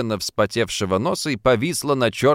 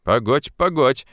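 ordinary delivery, nothing marked

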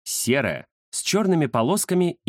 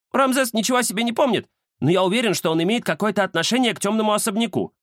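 high-pitched cat character voice; cat Кузя, explaining; 11 semitones higher, faster than usual. Acted. (высокий голос кота, объяснение)

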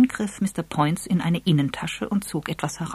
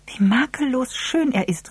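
put-on high-pitched, artificial girlish admiration; affected, lilting rapture; 9 semitones higher than usual. A narrator's reading. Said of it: "mit hoher, gekünstelter Stimme".

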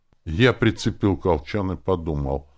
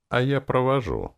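high-pitched eager offer; exclaiming; 9 semitones higher than usual. A narrator's reading. Lenora offers to escort the harp, exclaiming. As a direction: pipe up with the offer. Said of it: "воскликнула Ленора".

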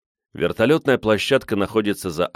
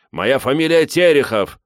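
indignant shout; 7 semitones higher, 5 dB louder than usual. A narrator's reading. Crowd members shouting to be let through.

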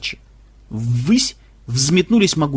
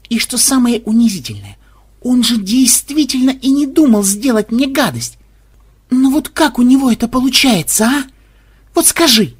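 indignant; 7 semitones higher, 4 dB louder than usual. Acted. Xenobyte's indignant appeal.

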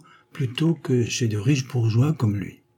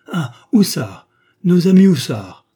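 louder, higher cry of recognition; triumphant discovery; 7 semitones higher, 9 dB louder than usual. A narrator's reading. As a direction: exclaim as the name finally comes to you. Husband suddenly finds the name. (exclamation triomphante)

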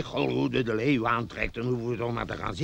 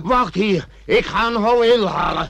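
higher, louder eager rush; excited; 9 semitones higher, 5 dB louder than usual. Acted. Treasure hunter rushing off for a pickaxe, eager and louder. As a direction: blurt it, giddy with greed.

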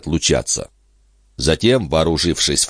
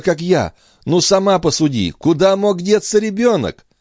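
forced cheerful reassurance; 12 semitones higher than usual. Acted. Brother feigning cheerful reassurance.

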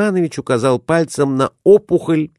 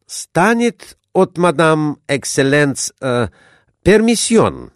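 put-on higher, slow accented captain; Spanish accent; 6 semitones higher, slower than usual. A narrator's reading. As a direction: ask permission in a halting foreign voice. Captain asks permission.

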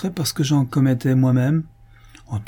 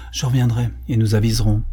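ordinary delivery, nothing marked

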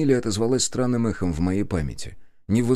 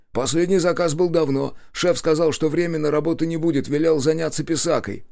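higher-pitched bandit voice; bandit answering questions; 9 semitones higher than usual. A narrator's reading. Bandit's answer in story dialogue.

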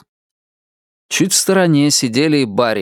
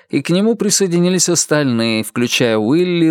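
ordinary delivery, nothing marked

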